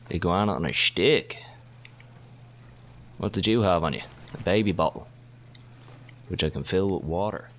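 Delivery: normal talking voice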